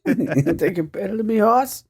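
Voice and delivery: high, mock-offended Boston challenge; Boston accent. Friend joining the bit as a Boston tough, put-on Boston accent. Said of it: "Do you have a Boston accent?".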